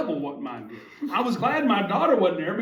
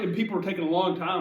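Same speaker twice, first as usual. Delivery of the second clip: normal talking voice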